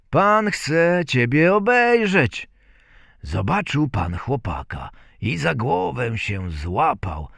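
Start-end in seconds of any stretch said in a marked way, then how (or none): 0.1-2.5 loud, high, slowish announcement; father calling out. A narrator's reading. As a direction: call it out to the little son. Father calls out to son. (głośne wołanie ojca)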